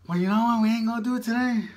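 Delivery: loud, high, fast declaration; excited outburst. Real, not acted. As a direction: blurt it out boldly. Sudden loud decisive outburst.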